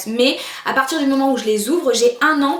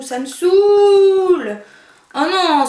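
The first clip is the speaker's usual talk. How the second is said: loud, drawn-out groan of annoyance; exasperated; 6 semitones higher, 6 dB louder, much slower than usual. Real, not acted. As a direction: let out your frustration, long and loud. Lens stuck, liquid spilling.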